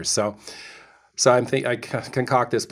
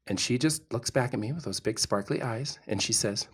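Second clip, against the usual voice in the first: usual speaking voice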